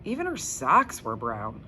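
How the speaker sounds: ordinary delivery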